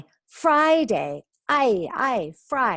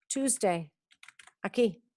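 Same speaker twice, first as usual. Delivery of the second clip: ordinary talk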